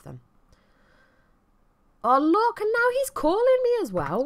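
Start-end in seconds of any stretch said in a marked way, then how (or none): 2.0-4.2 high, loud exasperated outburst; exasperated outcry. Real, not acted. Player spots another Sim calling her, exasperated outcry. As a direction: cry out in loud, high-pitched disbelief. Sees Vlad also phoning her.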